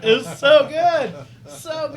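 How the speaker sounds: loud, high laughing praise; real delighted laughter. Real, not acted. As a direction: gush through a big laugh, drawing out the words. Cracking up at the story.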